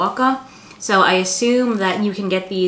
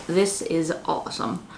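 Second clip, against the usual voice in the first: chewing while talking; speaking between bites; mouth full of pizza; 2 semitones lower, 6 dB quieter than usual. Real, not acted. Seen 2: Slice at mouth throughout, biting by 1.0; chewing likely while speaking.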